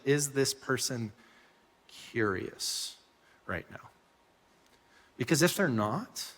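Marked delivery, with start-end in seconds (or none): 0.1-3.9 slowing and dropping, "curious" almost whispered; fading to a whisper. Shown as a demonstration. A preacher urging the congregation to pause, fading into a hush. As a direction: let the question sink to a hush. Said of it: "Just pause and ask that question".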